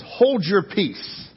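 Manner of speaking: "Hold your peace" spoken slowly, each word weighted; slow emphatic phrase. Shown as a demonstration. Preacher weighing the verse's words, slow emphasis. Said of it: "the word written there is".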